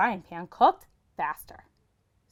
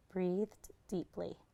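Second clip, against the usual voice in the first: ordinary delivery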